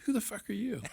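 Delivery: high, laughing incredulous question; retold disbelief, half laughing; laughing retort. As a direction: blurt it out through a laugh. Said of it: "I'm like".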